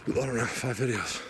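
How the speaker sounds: winded after the climb; panting while talking, out of breath. Real, not acted. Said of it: "Yes, I'm out of breath".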